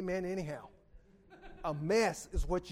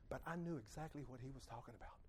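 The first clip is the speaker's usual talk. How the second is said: ordinary talk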